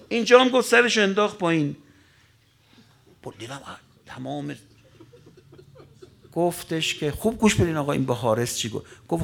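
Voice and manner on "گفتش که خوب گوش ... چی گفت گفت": ordinary delivery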